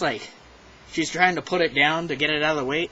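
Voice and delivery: usual speaking voice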